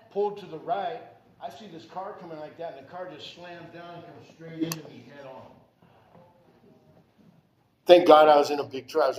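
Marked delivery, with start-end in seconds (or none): none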